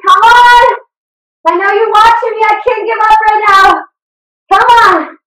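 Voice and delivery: shouting encouragement to the class; loud coaching. Real, not acted. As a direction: drive them on like a fired-up trainer. Coach urging class to not quit.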